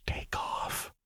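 barely voiced, secretive whisper; mouthed whisper. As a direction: mouth it so no one overhears. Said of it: "and then mouthed".

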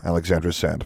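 ordinary delivery

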